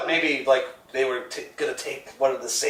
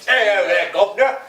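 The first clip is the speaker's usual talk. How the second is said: put-on South African accent; 7 semitones higher, 6 dB louder than usual. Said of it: "putting on a South African accent".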